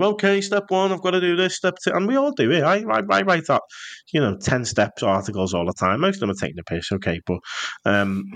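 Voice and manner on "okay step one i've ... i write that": higher mock step-by-step voice; formulaic planner. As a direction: rattle off steps like a checklist. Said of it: "following this kind of".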